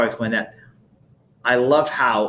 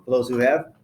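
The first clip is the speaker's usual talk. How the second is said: ordinary delivery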